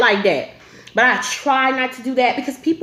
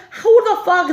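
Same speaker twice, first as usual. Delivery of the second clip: amused disbelief; 9 semitones higher, 4 dB louder, slower than usual. Real, not acted. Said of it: "I'm like, who the fuck".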